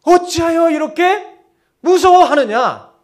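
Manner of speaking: loud, high, slow rebuke; stern reproach. Acted. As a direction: reproach them forcefully for their fear. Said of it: "책망하셨어요". (크고 높게, 천천히 책망하듯)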